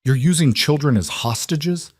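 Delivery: high, disbelieving question; can't believe what he's hearing; incredulous. A narrator's reading. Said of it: "Whitmore's voice was incredulous".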